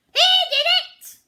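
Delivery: very high, very loud shriek; shrieking parrot. A narrator's reading. Parrot blaming Pete to the captain, shrieking. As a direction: screech it like a tattling bird. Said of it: "the purple parrot shrieked".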